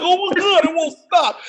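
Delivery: high, laughing anguish; mock agony with laughter; laughing in pain. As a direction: laugh through the burning. Burning mouth, retold.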